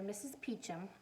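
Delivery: ordinary delivery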